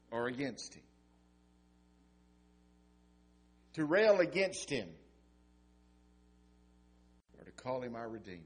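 rising and falling sermon cadence; preaching. Acted. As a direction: preach it with weight and pauses.

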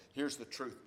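ordinary delivery

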